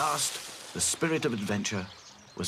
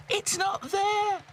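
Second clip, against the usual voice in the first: wailing; slower than usual. A narrator's reading. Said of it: "she wailed".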